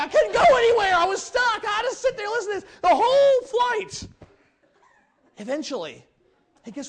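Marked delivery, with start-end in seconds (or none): 0.0-4.1 much higher, loud laughing protest; exasperated shout. Retold or imitated. Gary stuck beside a preacher on a flight, exasperated shout. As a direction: shout it, half laughing at being trapped.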